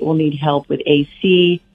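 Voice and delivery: ordinary talk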